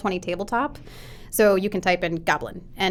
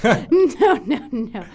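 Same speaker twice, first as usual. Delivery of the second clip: mock refusal; 4 semitones higher, 5 dB louder, much slower than usual. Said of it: "normally I would be like".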